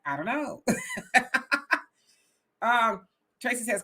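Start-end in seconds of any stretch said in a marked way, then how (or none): 0.0-0.6 much softer, trailing I don't know; quiet blank shrug. Shown as a demonstration. Showing Al what his head does, quiet blank shrug. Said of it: "So it's like". 0.7-1.8 high cackling laugh; real laugh. Real, not acted. Laughing at his own answer to Al, real laugh.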